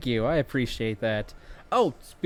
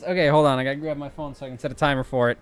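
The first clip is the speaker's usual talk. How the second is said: ordinary talk, nothing marked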